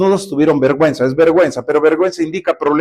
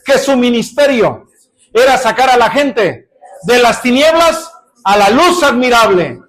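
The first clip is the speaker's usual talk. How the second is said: rising, louder preaching prayer; fervent prayer; 5 semitones higher, 4 dB louder than usual. Acted. Pastor praying aloud before the congregation, rising in fervor. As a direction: build to a higher, ringing plea.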